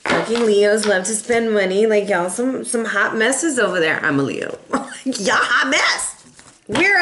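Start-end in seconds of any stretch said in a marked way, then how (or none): none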